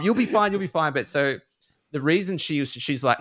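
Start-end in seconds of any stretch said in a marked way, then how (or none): 0.0-0.9 mock parents. Mocking the parents' reassurance.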